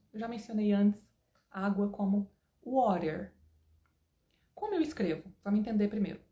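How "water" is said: American-style WATER, tapped T. Shown as a demonstration. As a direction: say WATER the standard American way, slowly. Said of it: "O inglês americano standard".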